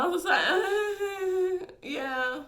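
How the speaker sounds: high, slow, pained laugh into a yeah; awkward laugh. Real, not acted. Answering his playing hard to get line, awkward laugh. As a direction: laugh it off uncomfortably.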